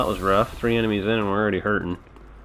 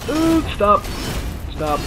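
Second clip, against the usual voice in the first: high, loud yelp; panicked yell; 14 semitones higher, 7 dB louder, slower than usual. Real, not acted. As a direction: shriek at the enemy.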